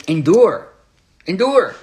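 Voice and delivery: high, punchy motivational cry; the world's slogans. Retold or imitated. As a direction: imitate a rousing slogan. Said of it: "Where the world says".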